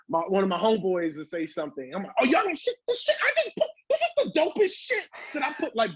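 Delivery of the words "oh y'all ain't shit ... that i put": much higher, bragging voice about own track; hyped-up self. Retold or imitated. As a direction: brag like a kid over your best song.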